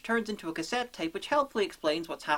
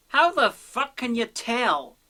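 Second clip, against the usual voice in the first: loud, high, drawn-out outburst; incredulous shout; 6 semitones higher, 8 dB louder, slower than usual. Acted. Reviewer on violence in Northern Ireland, incredulous shout. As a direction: yell it slowly in comic disbelief.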